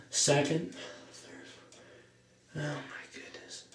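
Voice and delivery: hushed, breathless aside to himself; whispered aside. Acted. A boy whispering to himself over lunch times.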